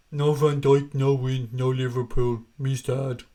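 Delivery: lightly voiced mock sulky fan; mocking a moaner. Streamer mocking a gloomy chat commenter, mock sulk. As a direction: parody a whining chat comment. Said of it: "laughing at that lad crying about Van Dijk".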